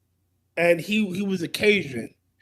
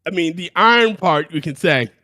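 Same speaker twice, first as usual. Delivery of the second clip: usual speaking voice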